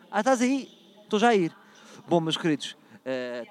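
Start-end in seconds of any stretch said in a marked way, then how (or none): 0.1-1.5 calling out. Real, not acted. Calling out to girlfriend.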